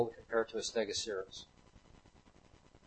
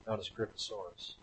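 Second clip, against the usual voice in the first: normal talking voice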